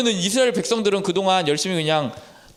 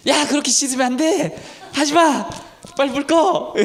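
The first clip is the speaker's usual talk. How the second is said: high-pitched nagging parent, scolding sharply; 8 semitones higher than usual. Retold or imitated. Mom scolding her daughter at the sink, high nagging. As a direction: scold her for washing it wrong. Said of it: "선생님 보면서".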